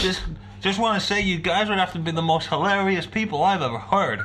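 high, hammed-up Australian accent; mock Australian accent. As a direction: play an over-the-top Aussie reading a fan email. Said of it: "This isn't Australian, is it?".

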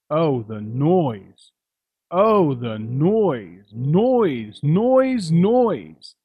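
building exasperated noise; mounting exasperation. A narrator's reading. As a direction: pile on the irritation with each noise. Grinch recalls hated noise.

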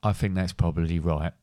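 low wrap-up voice; understated sign-off; signalling to stop recording. Real, not acted. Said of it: "you've just done it again".

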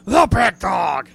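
loud, slow commentator call; Michael Cole. Shown as a demonstration. Host mimicking commentator Michael Cole for his co-host, loud slow announcer call. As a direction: boom it out like a wrestling announcer. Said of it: "I gotta sound like Michael Cole".

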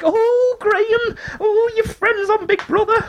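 put-on very high-pitched, drawn-out old-lady coo; squeaky mock old-dear voice; old dolls. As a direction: mimic excited old neighbours in a high, slow voice. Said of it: "the old dolls across the road".

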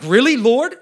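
loud, high exasperated "Really, Lord?"; retold yell at God. Pastor retells yelling at God at a conference, loud exasperation. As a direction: throw it up at heaven in disbelief. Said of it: "more like I was yelling at him".

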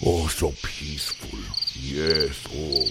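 hushed, dreamy diva meditating; serene murmur. Acted. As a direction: melt into blissful calm. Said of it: "get to a serene place".

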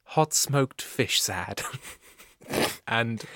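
low, soft, slow announcer; mock album advert. Host listing classics from the songbook, low mock announcer. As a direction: list the track like a TV commercial.

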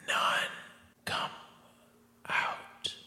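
faint dying last words; dying whisper. Acted. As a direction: barely get the words out.

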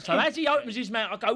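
ordinary delivery

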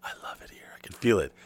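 breathy awed whisper, barely voiced; reverent whisper. Wrestler walking into dark stage, hushed awe. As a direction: whisper it like a man entering a sacred place. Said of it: "And he was like".

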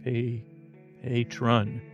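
ordinary talk

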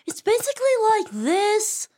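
breathy high-pitched character voice; Missy Foreman voice. Shown as a demonstration. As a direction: pitch way up and go airy like a shy teen. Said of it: "tries to do the Missy Foreman voice".

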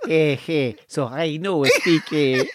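slow, halting mock Spanish accent; wobbly imitation of a Spanish speaker; put-on Spanish accent. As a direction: fumble along in a badly faked Spanish accent. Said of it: "is he a Filipino now?".

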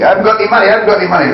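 high, whining mock plea; whiny pleading. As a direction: mimic a panicked dealer begging. Mimics the pleading victims.

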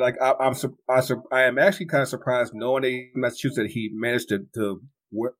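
ordinary talk

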